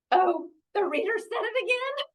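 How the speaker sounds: high, gleeful exclamation; excited story reading. Acted. Reader plays Elephant amused by the reader, excited. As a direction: act out delighted surprise up high.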